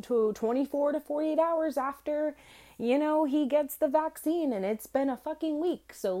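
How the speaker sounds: high, loud, snippy put-on voice for the vet; mocking the vet. Owner mocking the vet nurse on the phone, high and snippy. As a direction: mimic the dismissive vet nurse. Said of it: "And she's like".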